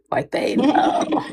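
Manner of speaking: slow, whispered, knowing. Acted. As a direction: lean in and whisper it slowly. Knowing aside about racism.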